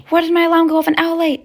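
high, loud alarmed question; panicked outburst. Acted. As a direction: exclaim in dismay at oversleeping. Acted panic on waking late.

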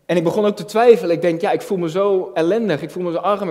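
ordinary talk, nothing marked

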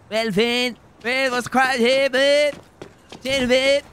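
high, loud Limerick greeting; Munster Limerick accent. Shown as a demonstration. Streamer showing the actor how, loud Limerick accent. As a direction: lay on thick Limerick Irish. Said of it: "do a proper Munster Limerick accent".

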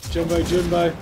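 loud excited call. Real, not acted. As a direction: announce the boss with gusto. Boss appears.

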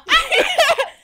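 put-on high, loud burst like a yelling child; screaming kid. Imitating a viral kid screaming at grandma, high loud shriek. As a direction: imitate the kid's scream, breaking into laughter. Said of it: "the little kid screaming at the grandma".

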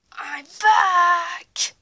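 loud, high triumphant villain roar; villain shout. Acted. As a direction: burst in with a roar.

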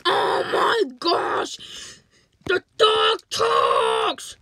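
slow, high hammed-up shriek; panicked scream. Discovering that the dog talks, panicked screaming. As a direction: scream each word out in mock panic.

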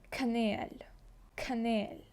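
«Канель» whispered, K with a puff of air; «Канель» breathed out softly; «Канель» aspirated K, twice; breathy K. Shown as a demonstration. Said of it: "наче видихаємо".

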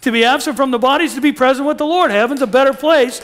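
loud, high, emphatic proclamation; exultant preaching. Real, not acted. Preacher celebrating heaven to the congregation, exultant. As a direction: proclaim it like fantastic news.

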